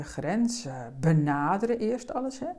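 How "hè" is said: ordinary talk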